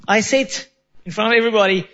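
ordinary delivery

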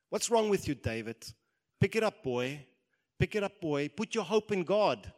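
loud, high-pitched rousing order; stern self-rebuke. Acted. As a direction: shout yourself out of despair. David rebukes himself in the mirror.

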